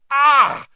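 sudden high, loud yelp of pain; cry of pain. A narrator's reading. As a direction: yelp as claws sink in. Harry clawed by the cat.

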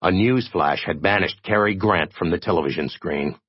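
usual speaking voice

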